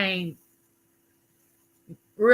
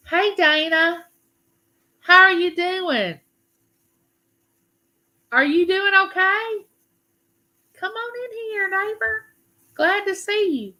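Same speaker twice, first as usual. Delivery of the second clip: cheery greeting; 12 semitones higher, 4 dB louder than usual. Real, not acted. Welcoming a viewer into chat.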